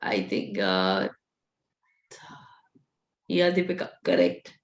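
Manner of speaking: hoarse, strained voice; a voice worn out from hours of teaching; losing voice. Real, not acted. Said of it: "I've almost lost my voice by now".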